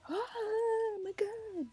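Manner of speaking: very high, drawn-out wail; crowd's mock swoon. Fan imitating everybody hearing the promise, mock swooning wail. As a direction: imitate a crowd of fans squealing in one long cry. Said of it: "And everybody was like".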